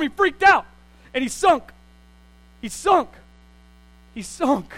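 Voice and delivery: loud, high punched "sunk"; loud preacher stress on "sunk"; emphatic repetition. Acted. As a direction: hammer "sunk" home each time.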